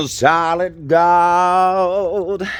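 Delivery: awed delight. Real, not acted. Gasping at the gold, drawn out in awe. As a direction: stretch it out, dazzled.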